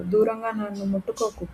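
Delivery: ordinary delivery, nothing marked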